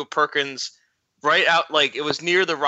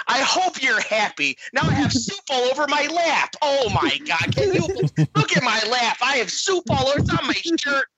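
high, upset Midwest woman; hammed-up Midwest accent; 10 semitones higher than usual. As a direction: overdo an outraged Midwestern complaint. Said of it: "her accent was what made it the funniest".

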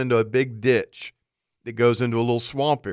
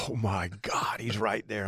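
hushed alarm; 2 semitones lower, 10 dB quieter, slower than usual. Retold or imitated. Turkey ten feet away.

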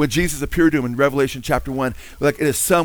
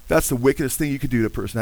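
normal talking voice

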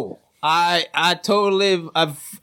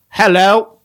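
loud hello; 8 dB louder than usual. Acted. Crab toll guard calls out.

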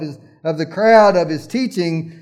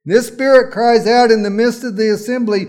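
higher, louder preacher's cry; preacher voicing the demon's cry, higher and louder; full-force preaching; 5 semitones higher, 7 dB louder than usual. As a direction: preach it out to the congregation.

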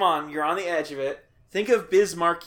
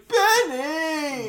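high, loud comic impression of a singer; 11 semitones higher, 5 dB louder than usual. Imitating [name] covering Benny and the Jets, high comic holler. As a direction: cry it out loud and high, drawn out. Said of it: "he sounds like [name]".

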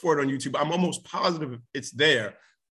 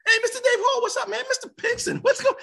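excited greetings. Real, not acted. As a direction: call out happily to familiar faces. Greeting audience members, calling out.

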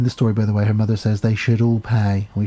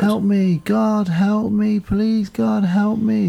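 mild mock scream; 9 semitones higher, much slower than usual. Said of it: "is what she screams".

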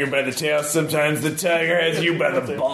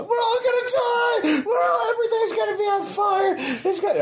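high wailing panic shout; mock panic. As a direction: cry out in hammed-up terror. Panic at containment protocol.

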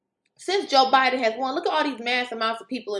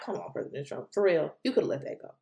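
exasperated aside; 9 semitones lower, 8 dB quieter than usual. Retold or imitated. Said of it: "I'm like".

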